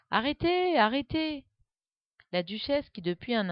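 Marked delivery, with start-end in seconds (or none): 0.1-1.4 high, loud cry to stop; bawling. A narrator's reading. Alice following Adi into the fight, bawling loudly. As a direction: yell it with all her strength. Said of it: "en braillant de toutes ses forces".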